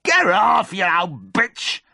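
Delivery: high loud shout, dragged out slowly; pained shout at cow. Acted. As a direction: shout at the cow crushing your foot. Cow standing on his foot.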